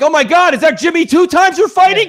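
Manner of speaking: imitating a giddy superfan; mock fan excitement. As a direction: play a fan thrilled by an obscure villain. Mimicking fans spotting obscure villain.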